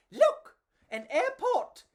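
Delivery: high, loud excited bug shout; excited cry. Acted. Enrico spotting the airport, high excited cry. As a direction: point and shout with delight. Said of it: "Enrico cried".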